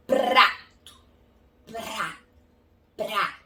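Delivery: "Prato" and "pra" with trilled R; P plus rolled R. Shown as a demonstration. Teacher blending P into rá, rolled R. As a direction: split "Prato", then roll "pra" slowly, with pauses. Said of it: "E depois seguir com o rá".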